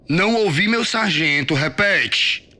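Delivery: high, loud sergeant dictating a reply; angry military shout; shouted drill command. A narrator's reading. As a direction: bellow it for him to repeat.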